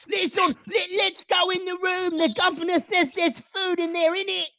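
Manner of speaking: high squeaky shout, Cockney rat; rally the swarm, high and shouting. Acted. Radicus rallying the rat swarm, high squeaky shout. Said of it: "Radicus shouts".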